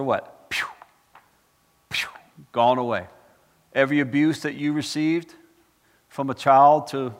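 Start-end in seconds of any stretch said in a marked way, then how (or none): 0.5-2.1 short imitated "pew"; whoosh sound effect. Preacher showing former things gone away, whoosh sound effect. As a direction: puff out a quick vanishing noise.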